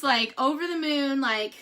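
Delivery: ordinary talk, nothing marked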